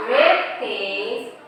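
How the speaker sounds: usual speaking voice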